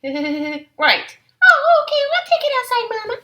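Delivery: very high, loud cub voices. Acted. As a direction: cheerfully give in. Cubs agree to go outside.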